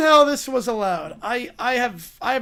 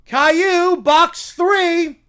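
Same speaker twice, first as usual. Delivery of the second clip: high, loud character call; called-out command; 4 semitones higher, 6 dB louder, slower than usual. Acted. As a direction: call it out like a game host. Acted character calls next box.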